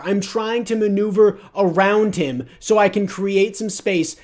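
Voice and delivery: loud, high, exasperated rant at the lineman; mad ranting. Real, not acted. Gamer breaking down the replay for viewers, angry and loud. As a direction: rant hard about the missed block. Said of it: "I'm mad".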